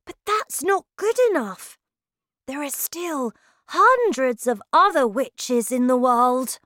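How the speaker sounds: put-on high, loud child's wail of disappointment; dismayed boy. A narrator's reading. Boy tells grandmother their work failed, dismayed high voice. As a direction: protest in a small boy's high voice.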